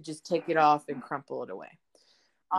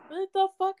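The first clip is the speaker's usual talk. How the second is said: much higher, louder retold outburst of disbelief; exasperated outburst; 13 semitones higher, 4 dB louder than usual. Quoting herself arguing with the neighbor, exasperated high outburst.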